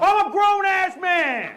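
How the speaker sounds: high offended yell; indignant shout. Retold or imitated. Buddy offered a lollipop at the doctor, offended high yell. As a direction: shout it like he's been insulted. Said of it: "You want a lollipop?".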